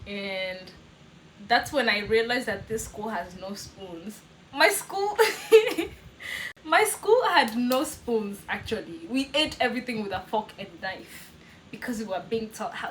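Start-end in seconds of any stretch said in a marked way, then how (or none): 4.5-5.2 high, amused, about to laugh; a sudden giggly squeak of disbelief. Real, not acted. Laughing at school with no spoons. 5.2-6.6 high, loud real laugh; burst of laughter. Real, not acted. Laughing at school with no spoons. 6.6-8.4 "school" drawn out and stressed, slow and falling; slow emphasis. Real, not acted. Stressing the absurd fact.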